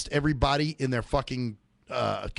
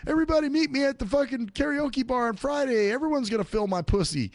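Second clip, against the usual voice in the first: a lightly voiced, higher mocking imitation of a ditzy friend; mock Whitney; 11 semitones higher than usual. Mocking Whitney's group text invite, lightly voiced, higher. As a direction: toss it off like a ditzy party invite.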